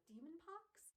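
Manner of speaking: high, rising incredulous question; lightly acted surprise; puzzled echo. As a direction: repeat it in baffled disbelief. Tessa puzzled by strange disease.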